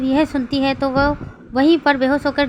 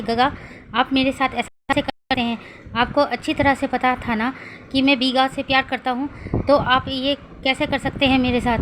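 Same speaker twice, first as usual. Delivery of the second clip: ordinary delivery, nothing marked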